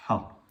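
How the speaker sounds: "Ha" as a strong breathy throat h, unvoiced; Arabic Ha. Shown as a demonstration. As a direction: push out "Ha" on a constricted h, separately each time. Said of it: "C'est le Ha". (« Ha » avec un h arabe soufflé du fond de la gorge)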